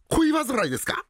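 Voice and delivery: ordinary talk, nothing marked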